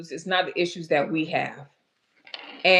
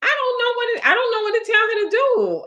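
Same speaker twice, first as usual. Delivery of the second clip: helpless high; 5 dB louder, faster than usual. Real, not acted. Helpless over audio problem.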